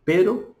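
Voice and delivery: Pero with one light flick of the r; Spanish single tap r. Shown as a demonstration. (Pero com r simples)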